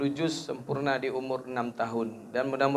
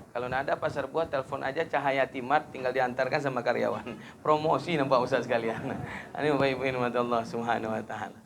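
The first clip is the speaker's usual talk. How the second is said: ordinary talk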